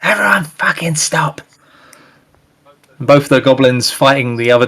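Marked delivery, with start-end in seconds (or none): 0.0-1.4 goblin screech. Acted. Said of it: "he screeches".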